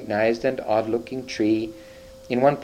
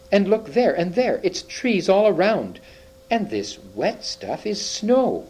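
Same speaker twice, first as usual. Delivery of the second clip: amazed; 9 semitones higher than usual. Acted. Peter sees the wood.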